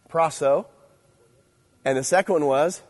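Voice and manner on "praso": Praso said as PRAH-so, first syllable stressed; teaching teens the Greek word Praso, PRAH-so. Shown as a demonstration. Teacher to students, Praso said PRAH-so. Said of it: "Praso is an emphasis on".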